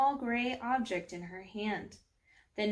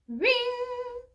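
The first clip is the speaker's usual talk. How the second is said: high, sung-out bell sound; imitated bell ring; 3 dB louder than usual.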